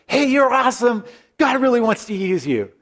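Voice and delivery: high, bold nervous blurt. Retold or imitated. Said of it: "he just kind of blurts it out".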